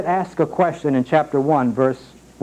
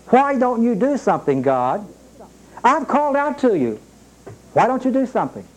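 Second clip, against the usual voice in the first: much higher, pleading why don't you do something; exasperated cry to God; 7 semitones higher than usual. Acted. Preacher acting a frustrated prayer.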